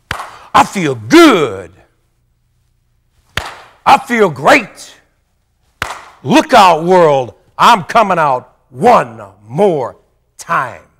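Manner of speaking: loud, slow, drawn-out self-hype; hammed-up morning pep talk to the mirror; pep talk shout. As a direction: pump yourself up, bellowing it out slow. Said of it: "I would give myself a pep talk".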